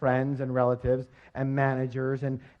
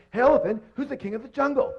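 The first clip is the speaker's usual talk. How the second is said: higher and louder demanding repeat; insistent lion; 10 semitones higher, 4 dB louder than usual. Acted. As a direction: yell it at the ignoring elephant. Ignored lion asks again louder.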